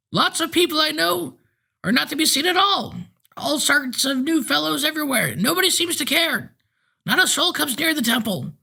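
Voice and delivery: possessed driver insists he is fine, thin and piping. A narrator's reading. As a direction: pipe it out like a frail eighty-year-old. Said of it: "in a thin, piping voice".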